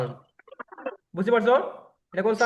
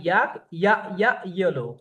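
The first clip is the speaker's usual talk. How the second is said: Ya, ya, yellow said slowly, stressing the first ya; slow teacher chant of Ya ya yellow; slow phonics drill; much slower than usual. Shown as a demonstration. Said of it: "The sound is ya".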